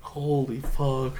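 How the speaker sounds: hushed, drawn-out amazement; quiet, slow shock; retold stunned disbelief. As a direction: stretch it out slowly under your voice. Retold stunned reaction to wrist.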